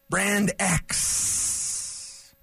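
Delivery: Jack Nicholson. Retold or imitated. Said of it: "So I sound like Jack Nicholson".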